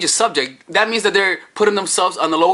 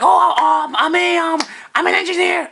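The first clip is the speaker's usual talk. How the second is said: much higher, halting answer; hesitant girl; 12 semitones higher than usual. Acted. Woman asked her job, high and hesitant.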